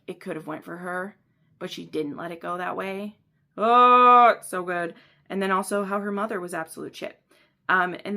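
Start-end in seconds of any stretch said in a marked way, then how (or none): 3.6-4.3 long, loud high moan of delight; swooning "oh". Real, not acted. Reader reacting to a scene so good, swooning. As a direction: let out a big overwhelmed "oh". Gushing over the book.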